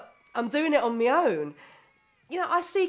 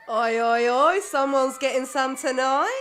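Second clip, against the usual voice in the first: loud, slow cheeky jeer; teasing call; 2 semitones higher, 7 dB louder than usual. Acted. Guest teasing the couple within earshot, loud cheeky jeer. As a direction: heckle them like a mate at the pub.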